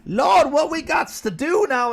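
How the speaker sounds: high, loud put-on churchy drawl; mock a politician pandering to a crowd; mock folksy Harris.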